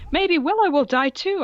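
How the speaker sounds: a hammed-up spiteful teen; mock gleeful goth. Reading fanfic dialogue aloud.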